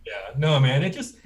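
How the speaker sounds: ordinary delivery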